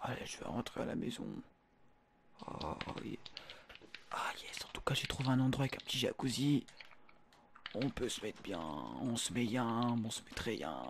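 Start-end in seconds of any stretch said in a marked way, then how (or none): 0.0-9.5 whispering. Acted. Sneaking back whispering.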